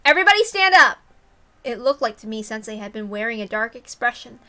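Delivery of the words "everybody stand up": loud, high call to the class. Acted. Class president calling the room to stand.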